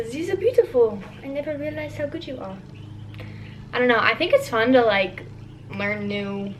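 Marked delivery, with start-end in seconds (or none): 0.1-2.6 higher Russian-accented line, then quick and soft. Shown as a demonstration. Showing viewers where her accent came out, Russian accent. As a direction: recite it in a Russian accent, trailing softer. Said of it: "when the accent actually came out".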